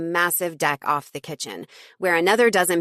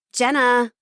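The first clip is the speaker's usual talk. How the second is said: sharp, higher and louder call of a name; stern call; 7 semitones higher, 5 dB louder than usual. Acted. Ashley calls sternly to Jenna from the couch, stern call. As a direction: call her name sternly across the room. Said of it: "Ashley called sternly".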